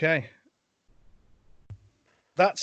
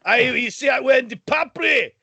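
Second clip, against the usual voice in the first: loud, higher put-on Scottish accent; Jamie McCrimmon Scottish voice; 6 semitones higher, 4 dB louder than usual. Scottish guest answering the host, loud Scottish accent. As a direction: call out in a thick Scottish brogue. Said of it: "alienate all our Scottish viewers".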